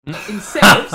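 high, loud, laughing disbelief; incredulous outburst. Retold or imitated. Retelling the receptionist call, a laughing incredulous yelp. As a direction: squeak it out in total disbelief.

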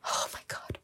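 gasping from a sudden foot cramp. Real, not acted. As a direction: caught by a foot cramp mid-sentence. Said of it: "my foot is cramping up".

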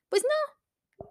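pues no leaping up high, rising at the end; playfully outraged pues no; incredulous high no. Real, not acted. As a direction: say no like it's absurd. Rejecting the bro rule again.

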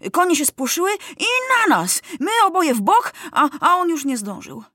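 high, animated eyewitness tale; boy dramatizing a scare for soldiers; excited yarn-spinning. A narrator's reading. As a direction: tell the accident breathlessly keen, pitch leaping. Felek spins the accident tale.

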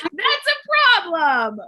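high, loud and slow, hammed-up indignation; pointed disapproval. Podcaster retelling her reaction to the reunion, indignant. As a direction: stretch each word out in mock outrage. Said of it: "I was like".

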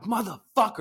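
high-pitched curse at the job; retold frustrated curse. Dad working in the garage, frustrated high curse.

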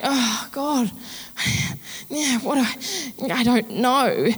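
sighing, lost for words; mock-helpless plea to God; flustered overwhelmed prayer. Telling God she's overwhelmed, sighing and lost.

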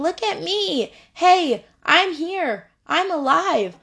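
high, eager calling voice as wisdom. Pretend. Wisdom calls out to passers-by, high and eager. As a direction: raise the voice and call out to be seen. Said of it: "She is saying".

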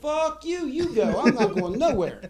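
high, loud retold refusal with a laugh; emphatic refusal. Refusing to go check the basement, high amused defiance. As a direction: dig in loudly, half laughing.

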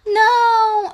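high loud cry of dismay; shocked cry. Real, not acted. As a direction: gasp and cry out no. Shock at the movie options.

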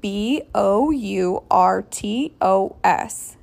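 slow letter-by-letter spelling; careful spelling. Real, not acted. Podcaster correcting the doctor's misspelled name, careful spelling. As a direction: spell it out slowly, one letter at a time. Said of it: "see if I can get this right".

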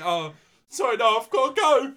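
high, loud Scouse excuse; Scouse accent. Retold or imitated. Actor making excuses to the little Scouser, loud Scouse. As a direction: blurt it out in Scouse as you flee. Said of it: "Good Scouse".

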